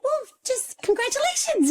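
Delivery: very high-pitched imitation of doctor; congratulating doctor. Doctor congratulating mother of twins, high gushing.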